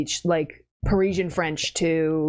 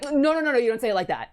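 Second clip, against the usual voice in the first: mock Parisian scolding; 7 semitones higher, faster than usual. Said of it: "the Parisian French were like".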